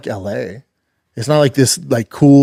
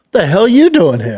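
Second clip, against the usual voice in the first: higher, louder mock surprise; surprised car-show fans; 7 semitones higher, 5 dB louder than usual. Imitating car show fans surprised to see a fighter, higher and louder. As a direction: act amazed to see him. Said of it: "all of them like".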